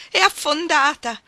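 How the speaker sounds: high loud cry of anguish, slowed; a loud, high cry of alarm. Acted. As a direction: cry out as the boat sinks. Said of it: "gridò con angoscia".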